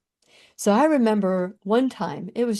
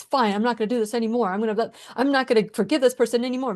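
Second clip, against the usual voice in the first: fast, tossed-off complaining; rapid mock grumbling; weary quitting rant; much faster than usual. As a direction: rattle it off as if fed up. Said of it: "that I'm weary, that I wanna give up".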